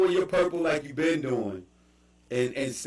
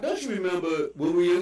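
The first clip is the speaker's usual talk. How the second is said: normal talking voice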